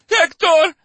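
high, loud desperate call; screaming a name. Acted. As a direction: cry out for your man. Wife calls for husband.

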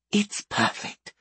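high, slow, whispery praise; breathy old woman. A narrator's reading. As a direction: savor it softly like a pleased granny. Tanis praises softly.

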